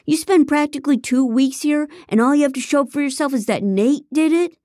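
high, loud exasperated shout; shouting at his partners in frustration; angry shout. Acted. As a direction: yell it at them, fed up. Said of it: "Ethan shouted".

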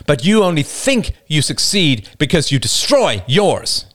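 a man arguing with rising passion; David's impassioned speech. Acted. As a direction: confront the child-beater with fervent conviction. David confronts Roman.

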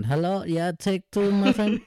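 high, hammed-up Mexican accent; a jokey Mexican cameraman calling the take, Mexican accent; mock Mexican accent. Mimicking a Mexican cameraman on set, high mock Mexican accent. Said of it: "a little Mexican in the corner going".

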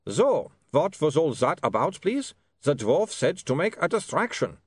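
put-on high, slow, accented vampire; vampire Otto, demanding an explanation. A narrator's reading. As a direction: ask for answers as an aggrieved vampire.

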